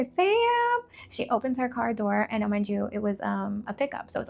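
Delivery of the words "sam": high loud call of the name; mother yelling for her daughter; mom calling out. Retold or imitated. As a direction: shout the child's name across the dark. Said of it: "then you hear".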